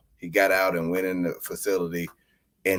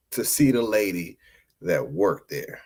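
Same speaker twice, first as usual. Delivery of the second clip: ordinary talk, nothing marked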